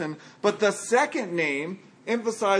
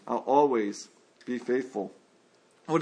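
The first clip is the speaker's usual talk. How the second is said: ordinary talk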